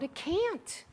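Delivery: ordinary delivery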